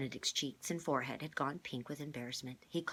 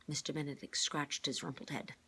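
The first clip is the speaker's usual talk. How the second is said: ordinary delivery, nothing marked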